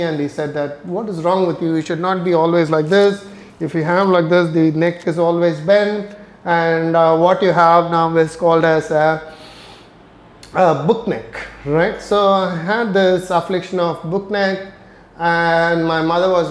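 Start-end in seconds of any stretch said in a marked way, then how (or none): none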